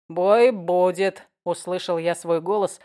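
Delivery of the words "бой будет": slow, loud slurred vow; drunk insistence. Acted.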